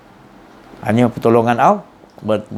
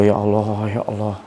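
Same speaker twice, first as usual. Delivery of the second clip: tearful prayer; 7 semitones lower, 5 dB quieter than usual. Retold or imitated. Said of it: "Menangis kita".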